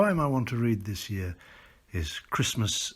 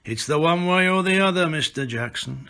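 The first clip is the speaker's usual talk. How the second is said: firm reply; 6 semitones higher, 5 dB louder than usual. A narrator's reading. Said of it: "he replied".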